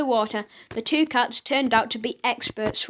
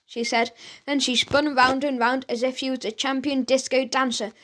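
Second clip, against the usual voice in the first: plain reading voice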